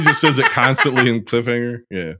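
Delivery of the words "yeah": ordinary talk, nothing marked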